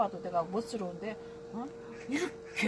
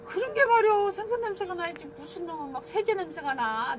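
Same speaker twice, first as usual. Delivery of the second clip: pitched way up and loud, griping; loud mock complaint; 10 semitones higher, 5 dB louder than usual. Retelling the complaint to the fish seller, high and loud. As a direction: squawk the complaint high and loud like an indignant neighbour. Retold complaint about detergent smell.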